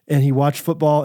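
ordinary delivery

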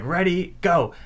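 referee's start call. Acted. Acting the referee starting a match.

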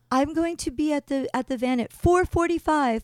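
high, firm announcement, imitated; bossy tour guide. Tour guide warning the group of departure time, high and bossy. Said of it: "She's already laying down rules".

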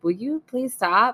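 high-pitched, drawn-out polite request; mock-gentle Canadian pleading; polite plea. Wife imitates her very polite husband who rarely objects, politely pleading. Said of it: "he's so Canadian and polite".

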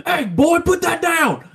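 high, loud mock shout at a kid; an angry dad yelling across the room, mock shout; retold yell. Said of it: "I heard from across the room".

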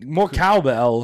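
high, slow celebrity impression; Christopher Walken. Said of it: "Christopher Walken".

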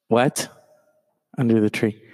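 normal talking voice

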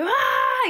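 high-pitched imitated scream; hammed-up high yell, held back in volume; mock scream. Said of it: "yelling at John David".

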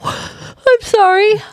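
slow, stretched words through a yawn. Real, not acted. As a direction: say it mid-yawn.

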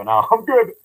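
high, loud mock refusal with a laugh. Retold refusal of Carrie's urging.

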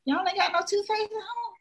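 high, disbelieving question; incredulous. Retold or imitated. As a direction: act shocked there's no toothpaste, pitch way up. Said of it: "I was like".

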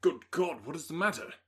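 loud, high alarmed outburst; shocked man blurts out in alarm; startled cry. Acted. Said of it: "cried he, with more feeling than politeness".

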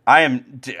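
ordinary talk

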